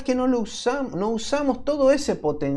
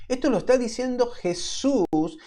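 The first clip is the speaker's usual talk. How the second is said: ordinary talk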